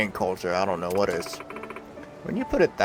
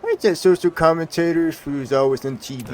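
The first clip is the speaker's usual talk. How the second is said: loud, higher sneering street thug; 7 semitones higher, 7 dB louder than usual. Acted. Thug confronting the TV star, sneering and loud. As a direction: lean in and taunt her like a punk.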